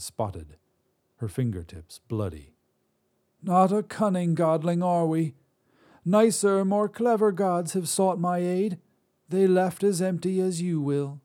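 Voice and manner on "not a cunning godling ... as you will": put-on high, scornful old woman; mocking old Drea. Old witch mocks the god, high and scornful. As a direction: taunt him like a sharp crone.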